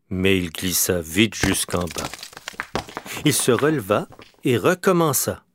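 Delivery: usual speaking voice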